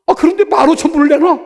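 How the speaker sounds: high, loud astonished telling; loud, high amazement; amazed outburst. Real, not acted. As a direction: blurt out the astonishing gift. Amazed at the gift.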